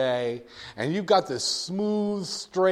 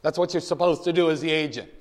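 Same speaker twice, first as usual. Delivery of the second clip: ordinary talk, nothing marked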